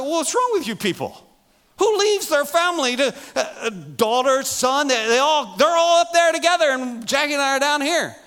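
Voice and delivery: high, loud mock outrage at the kids; hammed-up exasperated dad; half-joking indignant father; ticked-off rant. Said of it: "I'm a little ticked about that".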